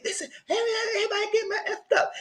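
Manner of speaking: squeaky, half-crying mock outburst, fast at the end; mock high yell. Guest mimicking Devin's outburst, high and cracking. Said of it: "And he said".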